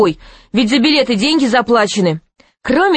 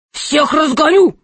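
higher-pitched royal outburst; angry shout; 7 semitones higher than usual. A narrator's reading. Angry king over the stadium noise, shouting. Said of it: "закричал он".

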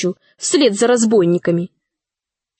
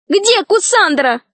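high, drawn-out demand; urgent high cry; 8 semitones higher, slower than usual. A narrator's reading. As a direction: call out the question, pitched high. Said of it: "закричала Алиса".

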